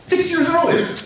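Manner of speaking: high-pitched, incredulous outburst; indignant exclamation. Real, not acted. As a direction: hit it high with real indignation. Lawyer's indignant point to jury.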